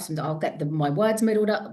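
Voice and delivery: ordinary talk, nothing marked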